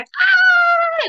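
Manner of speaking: put-on high, loud squeal of a running toddler; imitated toddler scream, very high; imitating happy kid's squeal. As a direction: squeal like a gleeful little kid. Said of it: "when they're happy".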